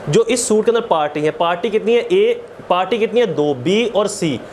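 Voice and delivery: ordinary delivery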